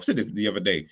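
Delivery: ordinary talk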